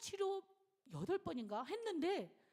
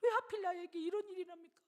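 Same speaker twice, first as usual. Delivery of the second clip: retold crying lament; 10 semitones higher, 4 dB louder than usual. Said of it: "그래서 막 우시".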